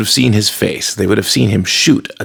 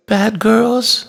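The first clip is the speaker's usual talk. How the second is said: put-on small, high girl's voice, a touch tearful; choked up; 13 semitones higher than usual. A narrator's reading. Chloe answers her stern uncle, choked up. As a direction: answer timidly, lightly choked. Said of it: "her voice choked up".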